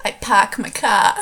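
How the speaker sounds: put-on broad Boston accent, dropping the r in park and car, said through a laugh; a quick laughing try at a Boston accent; amateur Boston accent with the r's dropped. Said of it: "I mean Boston. We'll go with Boston".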